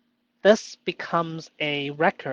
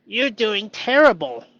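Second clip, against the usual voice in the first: louder, higher taunting voice; mean inner voice; 6 semitones higher, 7 dB louder than usual. Pretend. The mean internal voice attacking him, taunting. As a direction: sneer it like a nasty inner critic. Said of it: "mean internal voice comes in".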